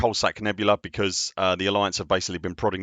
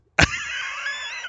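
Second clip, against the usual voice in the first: high laugh. Real, not acted. Laughing at the misread tones.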